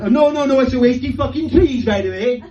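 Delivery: high, loud huffy refusal; mocking a disdainful Edinburgh local; snooty local. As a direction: brush off the flyer like a prim Edinburgh resident. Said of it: "Try and put a flyer into their hand".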